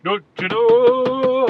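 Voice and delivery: put-on "Don't you know?" drawn out slow, loud and high in a Canadian accent; hammed-up Canadian accent. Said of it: "What accent is that?".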